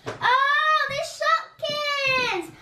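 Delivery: high, slow delighted coo. Real, not acted. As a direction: stretch it out in delight. Delighted unwrapping toy.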